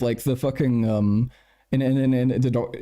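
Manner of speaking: ordinary delivery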